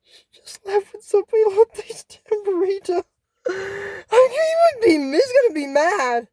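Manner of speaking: high-pitched, upset disbelief; distressed fan's high protest; dismayed outburst. Real, not acted. Upset about stolen tambourine.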